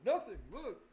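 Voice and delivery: loud, high "nothing, look"; teen yells to show the undented door; defiant teen shout. Retold or imitated. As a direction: shout it back at the parent.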